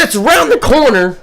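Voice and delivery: retold near-shout at a coworker who keeps asking; exasperated repeat. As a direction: shout the same answer at him a second time.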